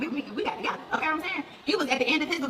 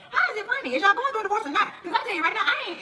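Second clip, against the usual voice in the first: incredulous outrage; 8 semitones higher than usual. Real, not acted. Incredulous rant.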